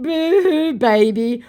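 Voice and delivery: high, loud fake sympathy; acted-out boo-hoo jeer; mocking baby taunt. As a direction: tease them like a crybaby.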